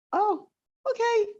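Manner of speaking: high, sing-song oh okay; awkward chirpy retreat; mock polite brush-off. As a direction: squeak it out like a polite escape. Retold own awkward reply.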